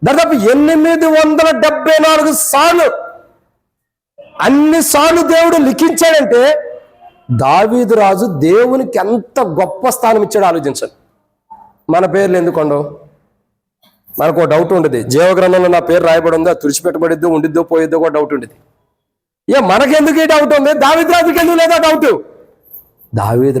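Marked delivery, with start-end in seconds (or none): 0.0-6.7 high-pitched, insistent preaching. Acted. 19.6-22.2 high, insistent rhetorical questioning; preacher's challenge. Acted.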